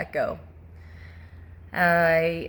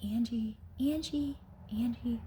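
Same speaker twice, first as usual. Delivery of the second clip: soft, higher, short repeated name; imitated soft calling; 5 semitones higher, 8 dB quieter than usual. Voice calling her name before bed, soft repeated calls. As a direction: call the name lightly and quietly. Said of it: "all the other times it said my name".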